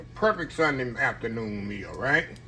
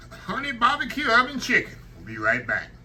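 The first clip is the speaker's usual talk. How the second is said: usual speaking voice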